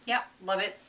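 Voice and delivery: usual speaking voice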